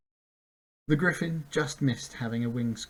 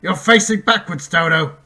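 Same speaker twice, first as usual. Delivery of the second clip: loud, higher scolding yell; 6 semitones higher, 14 dB louder than usual. A narrator's reading. Centaur scolding Bink, a loud higher yell. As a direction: bawl him out for his mistake. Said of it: "the centaur yelled".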